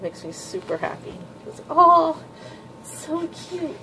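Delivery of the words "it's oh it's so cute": gushing squeal. Real, not acted. Manga fan gushing over little Mitsuki, high squeal. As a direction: squeal like you can't contain it.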